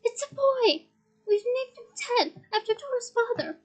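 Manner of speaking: high, overjoyed announcement; high excited cry of a new father; cried aloud with joy. Acted. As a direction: burst out with the happy news. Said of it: "then cried aloud".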